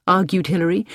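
ordinary delivery

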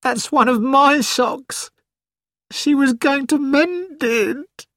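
high, slow, tearful voice; crying like a child. A narrator's reading. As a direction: near tears, voice breaking. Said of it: "He began to cry like a child".

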